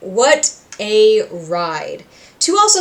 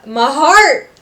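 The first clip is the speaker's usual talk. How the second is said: high, loud anguished outburst; heartbroken cry; 6 semitones higher, 9 dB louder than usual. Real, not acted. Fan overcome by Husk's plea, crying out loud.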